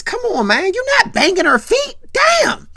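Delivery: very high, loud acted protest; exasperated outburst. Friend protesting at complaint about her feet, loud exasperated outburst.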